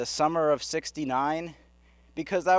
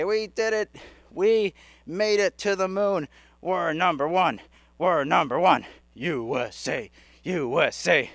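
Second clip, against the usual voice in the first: high gloating cheer settling into a chant; mock triumph; 3 semitones higher, 4 dB louder than usual. Americans boast after landing on the moon, mock-triumphant chanting. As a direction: crow it like a victorious crowd. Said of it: "and they say".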